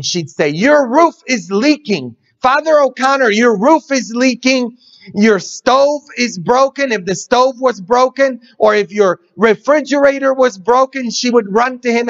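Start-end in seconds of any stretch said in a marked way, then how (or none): 0.5-6.9 high, urgent call to the priest; fussing housekeeper. Retold or imitated. Housekeeper hurries to the priest with a mock fluster. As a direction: run in fretting at Father.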